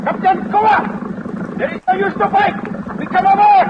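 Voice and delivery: high, carrying calls to the captain; shouting. Acted. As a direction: shout up at the captain across the water. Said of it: "He's shouting something".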